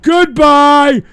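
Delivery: loud, angry yelling; furious shout. Real, not acted. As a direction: blow up and end the show. Shouting to end the show.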